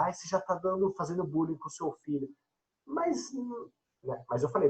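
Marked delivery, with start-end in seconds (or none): none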